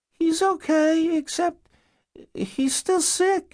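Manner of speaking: put-on very high, slow little-girl voice; young girl. Girl answering caseworkers through the door, young child voice. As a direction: answer in a tiny child's pitch, drawn out.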